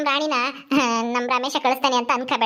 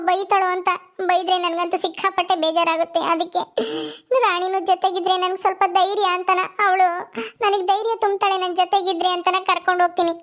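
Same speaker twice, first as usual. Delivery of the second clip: tearful pleading woman; 3 semitones higher than usual. Acted. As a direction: beg tearfully for a favour. Voices tearful Shilpa explaining.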